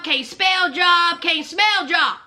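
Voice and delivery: slow, drawn-out mock of Joey. Vlogger mocking Joey's joblessness, slow and drawn out. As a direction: spell it out slowly, mocking him. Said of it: "mocking Joey slowly".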